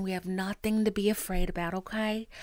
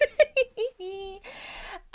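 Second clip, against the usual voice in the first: laugh; 11 semitones higher than usual. Real, not acted. Tarot reader joke.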